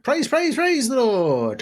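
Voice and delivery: high, slow, rising call of praise the Lord; excited command. Acted. Rider urging the horse to full speed, high and drawn out. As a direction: sing it out high to get the horse going.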